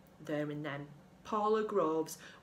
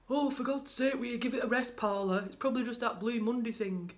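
exasperated; 3 semitones higher than usual. Acted. Husband snaps at questioning wife.

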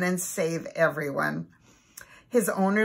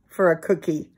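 ordinary delivery, nothing marked

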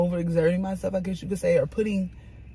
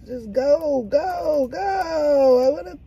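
loud, high, building whine; pleading whine; 7 semitones higher, 6 dB louder, much slower than usual. Real, not acted. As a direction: whine louder on each plea.

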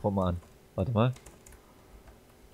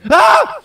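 sudden piercing scream of fright; jump-scare shriek, much higher and louder; startled scream; 18 dB louder than usual. Real, not acted. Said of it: "Da schreit er ins Mikro". (erschrockener Schrei)

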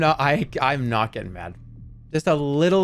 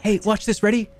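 put-on higher, lively coaxing voice for a child; reassuring parent; 4 semitones higher than usual. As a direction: count along to calm a scared kid. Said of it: "reassuring a kid in a storm".

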